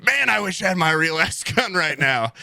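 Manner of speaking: high-pitched, meant to be overheard; pointed loud remark. Retold or imitated. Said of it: "Right in his ear and going".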